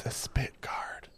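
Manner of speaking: unvoiced whisper. Real, not acted. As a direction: breathe it out as a whisper. Whispered correction during word search.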